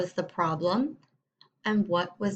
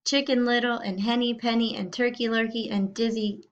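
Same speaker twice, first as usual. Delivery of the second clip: ordinary talk, nothing marked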